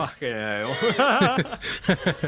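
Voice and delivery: drawled Cockney curse, slow; a slow, relishing Cockney swear; Cockney accent. Pretend. Caster cursing at a play in Cockney. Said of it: "my Cockney accent".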